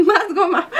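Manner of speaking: laughing greedy plea. Retold or imitated. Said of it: "ya saben".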